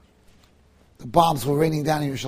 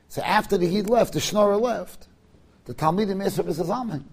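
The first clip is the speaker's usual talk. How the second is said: normal talking voice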